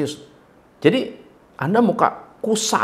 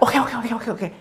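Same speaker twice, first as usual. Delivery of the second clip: higher, rapid string of okays; quick nervous okays; 6 semitones higher, 5 dB quieter than usual. Shown as a demonstration. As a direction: nod along too eagerly. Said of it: "Ngangguknya cepet-cepet begitu".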